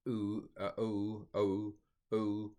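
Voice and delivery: "oo" as a gliding vowel; true diphthong. Shown as a demonstration. Teacher repeating a vowel sound, diphthong pronunciation. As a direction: draw out each "oo". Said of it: "a true diphthong".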